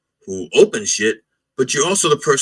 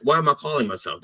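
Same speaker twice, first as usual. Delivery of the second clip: ordinary delivery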